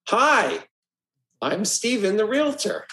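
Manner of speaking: high, smiling doorstep intro; friendly realtor hello at the door; smiling greeting. Shown as a demonstration. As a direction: greet them with a big smile, pitch well up. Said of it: "It's a smile".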